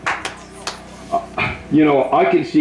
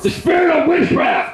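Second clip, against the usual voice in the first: high, shouted renunciation, much higher; shouted prayer. Real, not acted. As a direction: cast it out at the top of the voice.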